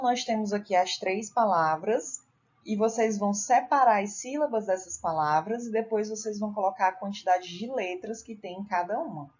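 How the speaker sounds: normal talking voice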